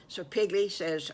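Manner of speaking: ordinary delivery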